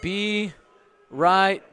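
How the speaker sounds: be heard over the siren; shouting over fire alarm. Real, not acted.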